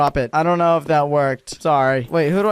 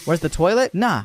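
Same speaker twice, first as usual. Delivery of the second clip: ordinary delivery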